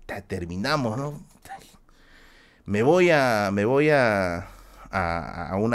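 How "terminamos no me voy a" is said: ordinary talk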